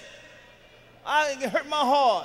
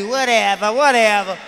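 imitating wife; 8 dB louder than usual. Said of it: "She gonna walk past me".

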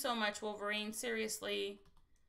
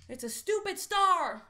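higher, louder, mock-disgusted jab; annoyed; 6 semitones higher, 6 dB louder than usual. Real, not acted. At a losing lottery symbol, mock-disgusted outburst.

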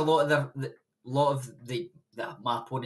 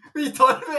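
laughing; 13 semitones higher, 7 dB louder, much faster than usual. Retold or imitated. Laughing while retelling his reply.

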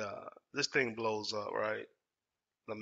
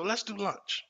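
higher-pitched put-on HR voice; [name]; 7 semitones higher, slower than usual. HR inviting the upset employee out, imitated friendly invite. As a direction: imitate a casual, placating lunch invitation. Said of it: "[name], H R calls me".

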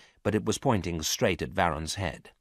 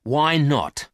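sharp loud demand; 7 semitones higher, 7 dB louder than usual. A narrator's reading. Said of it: "The woman agreed".

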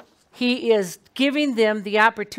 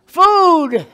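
emphatic reveal; 7 semitones higher, 10 dB louder than usual. Real, not acted. Revealing what came down.

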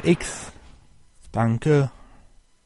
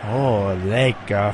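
slow, low, relishing oh lecker; yummy cook voice; 4 semitones lower, much slower than usual. Retold or imitated. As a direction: savour it like a greedy cook. Imitating the cook's delight.

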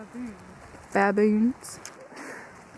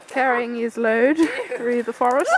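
nature documentary; 3 semitones higher, 6 dB louder than usual. Pretend. Documentary narration continues.

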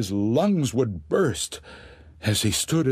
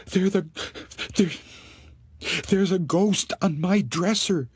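trembling; 8 semitones higher than usual. Acted.

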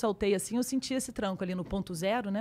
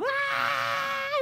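hysterical scream. Retold or imitated. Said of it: "Eu parecia uma histérica maluca".

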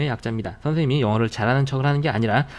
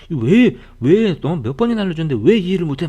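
loud, higher-pitched exasperated scolding; annoyed teacher; 5 semitones higher, 9 dB louder than usual. Acted. Teacher fed up with re-explaining to a student, annoyed. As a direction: act out a teacher losing patience. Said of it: "선생님 막 짜증낸다고".

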